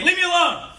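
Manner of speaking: high, loud firm shout; loud command. Shown as a demonstration. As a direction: shout it as you step back. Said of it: "be loud and verbal with it".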